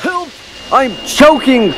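high, loud panicked plea; choking cry for help. Acted. Choking engine calls for rescue, desperate high yell.

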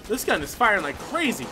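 ordinary talk, nothing marked

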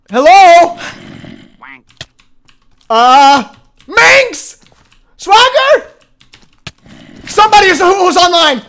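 high, loud calls into the house; calling out loud. Real, not acted. Streamer calls out to the players in his house, loud and high. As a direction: call out for whoever is there.

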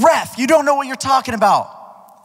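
high, loud mocking yell at the ref; hammed-up angry player; angry heckler. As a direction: play the furious kid yelling at the ref. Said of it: "he keeps saying".

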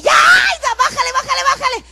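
high, loud alarmed yelling; panicked husband shout. Retold or imitated. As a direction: yell like a nervous husband in the car. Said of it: "mi esposo está". (esposo asustado gritando: bájale)

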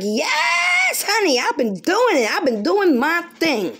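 imitating someone brushing God off with a high sweet voice; mock innocent reply. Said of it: "And you're like".

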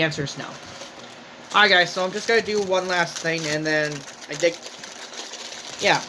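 chewing while talking. Real, not acted. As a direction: mouth partly full. Seen 2: Cheeks full, jaw working; napkin held to mouth mid-stretch.

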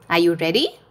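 ordinary delivery

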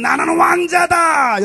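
shouted proudly, high and slow. Shown as a demonstration. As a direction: proclaim it at full voice. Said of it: "다시 한번".

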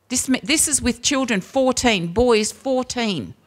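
usual speaking voice

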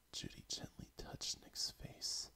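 ordinary delivery

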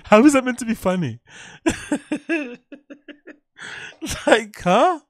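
high, loud disbelief with a laugh; can't believe anyone finds this funny; incredulous. Real, not acted. Mocking the comedian's joke.

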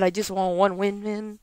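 normal talking voice

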